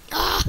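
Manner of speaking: short, loud, high "ah"; sudden frustrated cry; startled yell. Real, not acted. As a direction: yelp as the game catches you out. Killed in the game.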